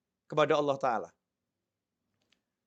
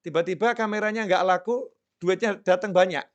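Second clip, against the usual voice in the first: ordinary talk, nothing marked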